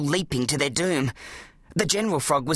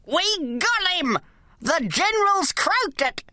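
put-on high, slow gleeful old man; triumphant; 12 semitones higher, slower than usual. A narrator's reading. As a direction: crow over the victory. Gramps celebrating victory.

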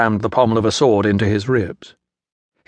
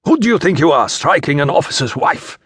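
outraged soldier; 5 semitones higher than usual. A narrator's reading. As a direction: berate a prisoner for his insolence. Soldier berates prisoner while beating him.

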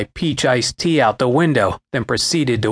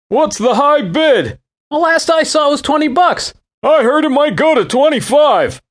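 playful teasing; 13 semitones higher, 4 dB louder than usual. Retold or imitated. Teasing joke about the eBay bid.

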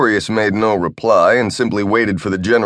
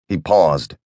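usual speaking voice

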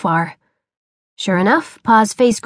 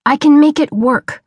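higher, crying out in protest; cried out; 5 semitones higher, 4 dB louder than usual. Acted. Boy defends his invention to father, crying out. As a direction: push back hard, pitch up. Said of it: "Frank cried".

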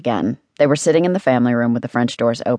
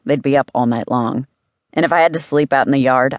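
plain reading voice

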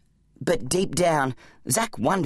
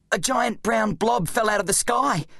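higher-pitched, urgent explaining voice; Zack, an exasperated boy hero; 7 semitones higher than usual. Acted. Boy hero's voice in story.

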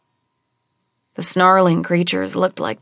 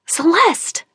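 hissed call; 11 semitones higher than usual. Acted. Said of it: "I hissed".

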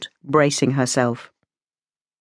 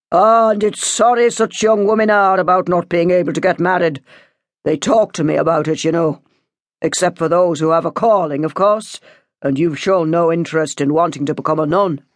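put-on measured priest addressing a young parishioner; solemn pastoral talking-to; lecturing priest. A narrator's reading.

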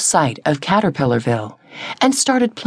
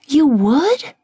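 surprised; 8 semitones higher than usual. A narrator's reading. Disbelief at new friend.

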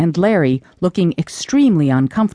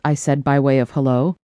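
ordinary delivery

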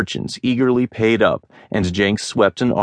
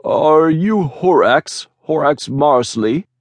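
high, slow, timid merchant voice; a shy trader working up his nerve; embarrassed merchant; 8 semitones higher, 4 dB louder than usual. A narrator's reading. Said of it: "looking embarrassed".

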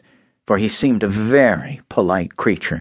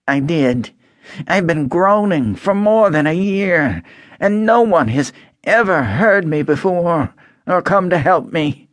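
higher, mournful rusted tin man; sad Tin Man voice; 7 semitones higher than usual. A narrator's reading. Rusted Tin Man telling Dorothy of his long wait, mournful. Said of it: "moved by the sad voice".

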